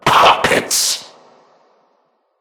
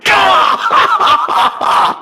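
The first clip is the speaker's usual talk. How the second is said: high shrieking laugh of triumph; a villain's victorious cackle; triumphant laugh. Acted. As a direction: burst into a manic, gleeful laugh.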